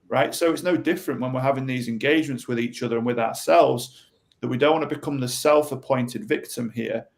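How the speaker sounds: normal talking voice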